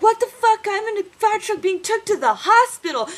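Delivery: put-on high, loud frantic girlfriend voice; mock panicked girlfriend. Girlfriend calling from fire truck, high frantic panic. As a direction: squeal it like she's panicking in the truck. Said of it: "I was like".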